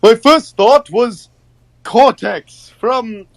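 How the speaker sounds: high, slow cartoon villain voice; slow high-pitched cartoon character; Cortex. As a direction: stretch it out in a high, put-on villain voice. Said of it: "Cortex from, uh, Crash, where he's like this".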